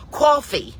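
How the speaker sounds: "Coffee" high and loud, falling; mimicked high-pitched "Coffee"; waitress's echo. Waitress finally echoes the word.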